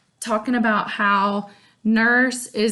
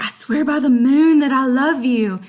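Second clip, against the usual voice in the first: loud, high lovesick vow; Romeo swearing; 4 semitones higher, 6 dB louder than usual. Retold or imitated. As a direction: declare it loud and lofty. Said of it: "he's like".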